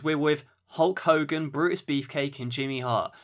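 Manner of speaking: normal talking voice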